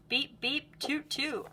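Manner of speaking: high, bright beep beep toot toot like a toy car; playful honking. Pretend. Teacher reading to kids, toy horn voice.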